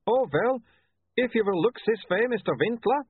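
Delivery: a hint of an Uberwaldian photographer; lightly voiced Uberwaldian accent. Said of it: "don't make fun of his accent".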